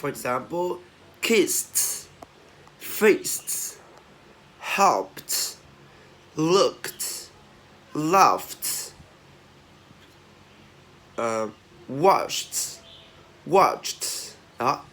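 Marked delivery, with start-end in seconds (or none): 1.2-8.9 "kissed, fixed, helped" ending in a released t. Shown as a demonstration. Teacher drilling T endings, "kissed, fixed" with popped t. 11.9-14.3 "watched" ending in a released voiceless t. Shown as a demonstration. Teacher drilling T endings, "watched" with popped final t.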